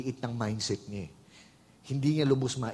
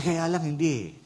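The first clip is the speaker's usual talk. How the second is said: normal talking voice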